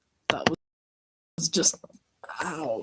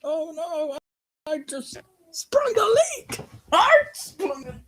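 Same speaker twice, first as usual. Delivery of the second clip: panicked squeaky voice; 14 semitones higher, 3 dB louder than usual. Acted. The leaking character panics.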